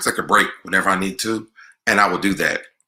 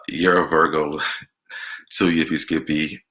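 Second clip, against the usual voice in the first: ordinary talk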